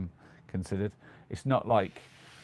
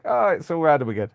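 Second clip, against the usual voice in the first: mock exasperation; 7 semitones higher, 9 dB louder than usual. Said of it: "Noah's like".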